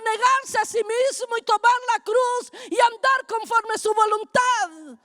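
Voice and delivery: forceful pulpit proclamation; emphatic preaching. Real, not acted. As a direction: drive each phrase home to the congregation.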